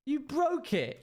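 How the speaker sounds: put-on high wailing child accusation; crying child. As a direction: imitate a tearful six-year-old. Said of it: "Like, tears, in tears".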